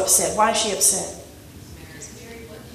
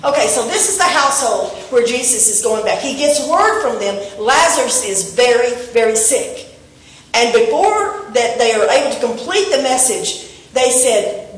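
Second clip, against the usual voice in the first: ordinary delivery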